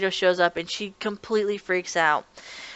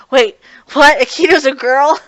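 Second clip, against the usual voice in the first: imitating surprise; 6 semitones higher, 9 dB louder than usual. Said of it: "he's like".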